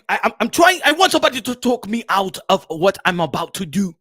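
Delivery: African boxer pleading on the phone; agitated, halting African-accented voice; Makabu. Acted. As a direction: stammer towards a desperate confession.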